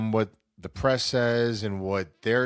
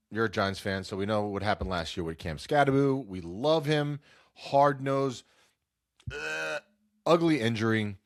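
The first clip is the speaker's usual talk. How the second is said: ordinary delivery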